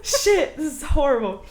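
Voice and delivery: high, loud British accent, laughing; animated, laughing British accent. Shown as a demonstration. Guest unable to switch it off, laughing British accent. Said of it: "I don't know how to turn it off".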